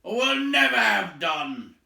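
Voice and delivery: loud and high troll complaint; angry troll. A narrator's reading. Trolls scolding Tom, loud and high. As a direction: keep shouting at him. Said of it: "said they".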